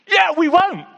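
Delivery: high, loud outburst; sudden high-pitched exclamation; emphatic exclamation. Real, not acted. Preacher exclaims mid-appeal.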